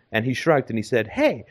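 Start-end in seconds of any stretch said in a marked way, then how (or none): 1.1-1.4 higher, grinning carefree shrug of a voice; a hint of cocky self-satisfaction; smug grin. Simon grinning at the friend who questions him, lightly smug. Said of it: "looked at me with a big grin".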